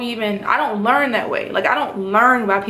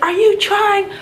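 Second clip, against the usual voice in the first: much higher, slow, mocking the instructor's irritated question; imitating an irritated instructor, much higher; 11 semitones higher, slower than usual. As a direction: mimic the exasperated instructor, pitched way up. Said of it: "He's like".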